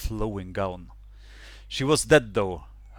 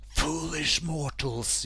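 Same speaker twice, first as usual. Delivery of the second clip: slow, eerie voice from beyond; a ghost's slow, hollow warning; dark lord; 6 semitones higher, 3 dB quieter, slower than usual. Acted. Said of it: "sound from beyond".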